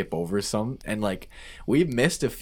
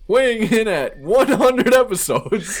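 loud, high, long-drawn arena announcement; hammed-up boxing introduction; ring announcer; 13 semitones higher, 8 dB louder, slower than usual. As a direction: bellow the title like a fight-night headliner.

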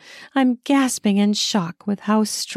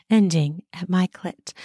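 usual speaking voice

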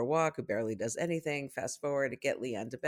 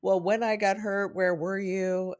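higher, louder mock accusation; retold indignation, stretched out at the end; imitated child's reproach; 6 semitones higher, 4 dB louder than usual. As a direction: play a hurt kid laying blame. Child blaming parent, retold.

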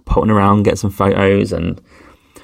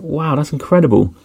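higher, wide-eyed wow of amazement; grateful amazement, voice lifted high; amazed; 7 semitones higher than usual. Retold or imitated. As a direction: marvel at a stranger's kindness, pitched up. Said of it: "I was like".

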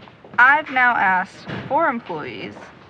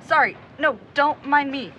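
ordinary talk

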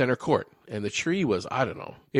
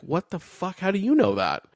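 put-on higher, astonished child's reaction; amazed kid; 8 semitones higher than usual. Host voicing his childhood amazement at the talking tree. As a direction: gasp it out like a stunned kid.

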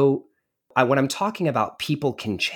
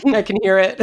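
laughs while answering; laughing; 14 semitones higher, 9 dB louder, faster than usual. Real, not acted. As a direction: warm amused laugh. Laughing at her crying.

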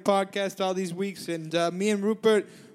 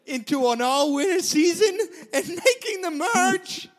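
much higher and louder, laughing through it; giddy excitement; 8 semitones higher, 3 dB louder than usual. Real, not acted. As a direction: crow with delight about making the merge. Gloating over making the merge.

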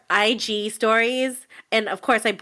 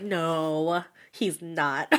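lower, quieter, laughing "No, he's not"; deadpan refusal to believe it; flat disbelief; 6 semitones lower, 6 dB quieter, much slower than usual. Retold or imitated. Fan reacting to a celebrity following her, low disbelief. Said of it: "I was like".

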